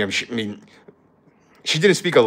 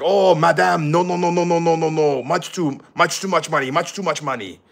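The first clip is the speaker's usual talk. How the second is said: higher-pitched rapid-fire refusal, imitating a French tourist haggling; imitated French girl; 5 semitones higher than usual. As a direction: mimic a French customer protesting the price. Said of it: "the French girl, she wasn't stupid".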